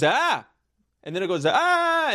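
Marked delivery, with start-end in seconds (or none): 0.0-0.5 mock yell. 1.4-2.1 high piercing fake scream; acting the victim as the shocks grow; mock scream of pain. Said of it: "the total screaming".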